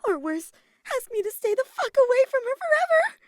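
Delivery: high, frantic worrying; spiralling into a squealed freak-out; panicked thoughts. A narrator's reading. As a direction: build from anxious questioning to a loud, high panic. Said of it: "so many thoughts running through her mind".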